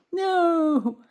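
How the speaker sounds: high, loud exclamation; a loud, high refusal of the worry; emphatic no. Acted. Rejecting the fearful thought emphatically.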